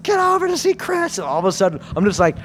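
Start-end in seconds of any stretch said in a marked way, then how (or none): 0.0-1.2 very high, drawn-out panicky voice; panicked girlfriend. Retold or imitated. Storyteller voices girlfriend phoning roommate, high-pitched panic. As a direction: imitate her frantically calling for help. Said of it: "calls my roommate".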